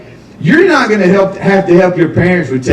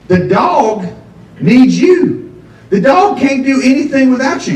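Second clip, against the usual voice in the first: higher, pressing the point; emphatic lecturing; 7 semitones higher than usual. Acted. To the audience about dogs, emphatic and higher. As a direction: drive it home to the crowd.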